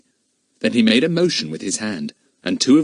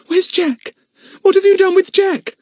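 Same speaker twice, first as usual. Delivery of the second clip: put-on frightened girl; 7 dB louder than usual. A narrator's reading. Frightened girl looking round wildly.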